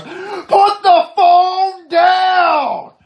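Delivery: high, drawn-out shouted command; slow, high-pitched yell; shouted cop order. Retold or imitated. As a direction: bellow it like a cop, each word stretched. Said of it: "the cop says to me, he goes".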